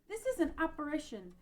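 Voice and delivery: ordinary talk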